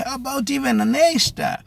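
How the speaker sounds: high, loud burst of enthusiasm; excited exclamation. Real, not acted. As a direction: top it off with rising excitement. Excited topping of the grades.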